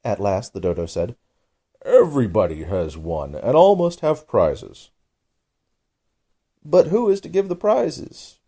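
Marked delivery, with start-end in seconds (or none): 1.8-4.9 dodo verdict. A narrator's reading. Dodo announces solemn verdict. 6.6-8.4 much higher, louder crowd voice; a high, eager chorus of birds; high, louder crowd of birds asking together. A narrator's reading. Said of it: "quite a chorus of voices asked".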